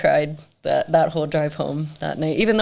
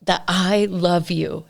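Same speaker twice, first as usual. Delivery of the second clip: slow creepy pleading; slower than usual. Retold or imitated. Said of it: "asking me questions like".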